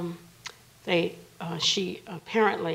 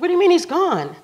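high, loud incredulous question; raised-voice alarm, reenacted; alarmed disbelief; 12 semitones higher, 12 dB louder, faster than usual. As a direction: demand it in shocked disbelief.

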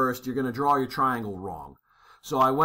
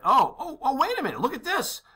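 mock surprise; 10 semitones higher than usual. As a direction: pitch jumps up high in theatrical discovery. Teacher feigns discovery in Bowditch.

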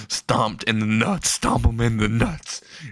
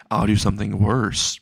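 ordinary delivery, nothing marked

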